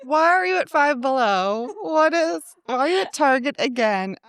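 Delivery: mock nagging. Sister tracking sister's shopping trips, mock exasperated.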